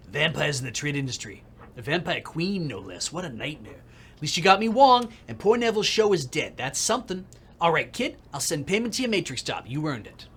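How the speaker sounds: brash New York producer, lightly voiced; fast-talking New Yorker boss; gruff New York accent. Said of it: "terrible accent".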